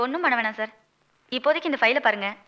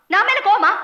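louder and higher, pushing the question; loud repeat; 6 semitones higher, 6 dB louder than usual. Acted. Wife demanding an answer from husband, loud. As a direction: fire the same question back hard.

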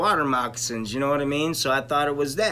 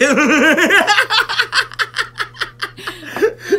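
long rising high laugh; real helpless laughter; high wheezing laugh; 7 dB louder than usual. Real, not acted. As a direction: crack up at the punchline. Laughing at his own story.

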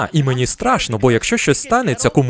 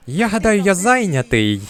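mock excuse; 7 semitones higher than usual. Comic tells his agent he's busy, mock excuse. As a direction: stretch out a high, feigned-innocent refusal. Mock reply declining a free gig.

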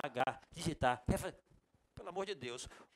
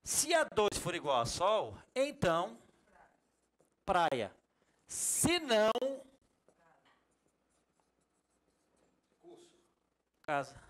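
slow reading with se não set apart; reading it differently; 2 semitones higher, slower than usual. Shown as a demonstration. Teacher rereads the formula the new way to students. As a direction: read it the new way, with se não standing out. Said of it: "eu vou ler diferente".

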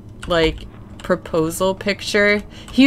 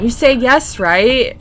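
mild mock yell of excited onlookers; louder, lifted imitation of screaming girls; retold girls' scream; 5 semitones higher, 6 dB louder than usual. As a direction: lightly imitate an excited crowd. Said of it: "girls were screaming".

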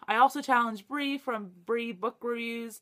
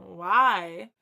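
normal talking voice

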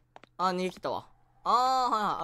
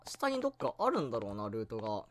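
normal talking voice

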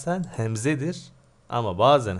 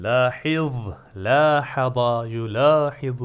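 loud recitation of forms; 6 dB louder than usual. Shown as a demonstration. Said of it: "Bak şimdi".

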